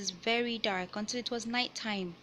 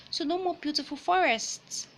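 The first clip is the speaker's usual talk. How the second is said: ordinary delivery, nothing marked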